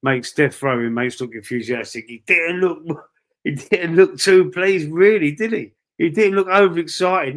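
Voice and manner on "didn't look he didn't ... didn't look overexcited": laughing mockery. Real, not acted. Laughing at Rashford's stern face.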